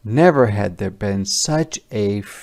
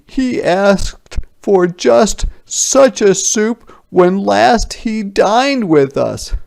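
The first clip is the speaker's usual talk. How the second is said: much higher, louder grand soldier; name-drop the king with relish; soldiers boasting; 11 semitones higher, 8 dB louder than usual. A narrator's reading. Soldier bragging of the king to peasants, much higher and louder. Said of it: "said the soldiers".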